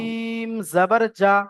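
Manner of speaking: slow, long "জিম", then short "জা"; corrected stretch. Shown as a demonstration. Teacher correcting a student's reading, "জিম" long and "জা" short. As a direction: stretch "জিম" but never hold "জা". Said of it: "না, জায় টান হবে না".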